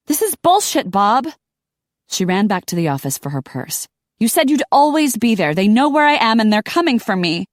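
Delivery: high, loud furious rant. A narrator's reading. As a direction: rip into him on voicemail. Angry voicemail rant.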